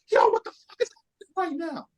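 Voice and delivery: very high, strained squeal of disbelief; high-pitched mock panic inside your head; inner freak-out. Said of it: "But in my brain, I'm like".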